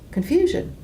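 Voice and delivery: stunned repeat of Confusion? with a steep rise; incredulous echo. Real, not acted. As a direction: throw the word Confusion? back, high and baffled.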